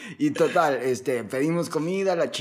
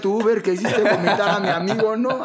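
louder and higher, laughing indignation; hammed-up drunk comeback to the driver; laughing mock outrage at the driver; mock indignant; 6 semitones higher, 4 dB louder than usual. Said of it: "emputadísimo así".

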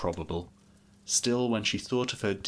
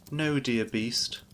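usual speaking voice

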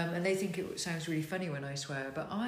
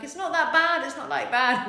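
put-on protesting teen; 9 semitones higher, 9 dB louder than usual. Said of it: "And I'd be like".

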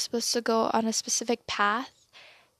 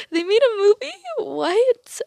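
much higher and louder, shrieking disbelief; shocked disbelief; 11 semitones higher, 10 dB louder, slower than usual. Retold or imitated. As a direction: act out the shock of finding the movie. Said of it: "and I was like".

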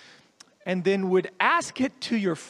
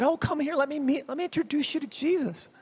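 higher, sugary mock-friendly invitation; sarcastic pretend hospitality; mock welcome; 7 semitones higher than usual. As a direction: crowd pretending to welcome the beggar. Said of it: "It's not at all what happened".

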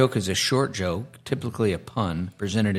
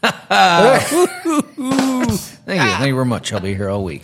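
brash vaudeville comic; 9 semitones higher, 5 dB louder, slower than usual. Pretend. Mock standup sign-off after joke.

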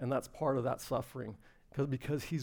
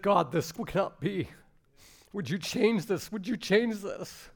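slow, high-pitched plea to God; crying out in prayer; 6 semitones higher, slower than usual. Retold or imitated. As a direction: retell a desperate prayer, drawing it out. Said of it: "you cried out".

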